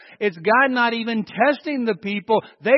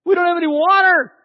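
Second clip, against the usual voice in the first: high, loud whining complaint; hammed-up panicky moaning crowd; whiny griping; 9 semitones higher, 6 dB louder than usual. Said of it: "they're griping to Moses and they say".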